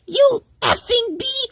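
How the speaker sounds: high-pitched imitation of an irate coworker; mock angry yelp. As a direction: yelp an irate, scolding outburst. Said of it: "he yiped up. He was like".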